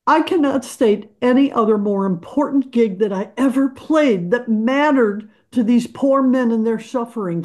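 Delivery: usual speaking voice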